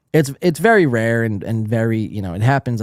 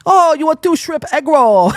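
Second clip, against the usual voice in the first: mock Chinese accent=101; 12 semitones higher, 5 dB louder than usual. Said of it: "it's fitting to do a Chinese accent".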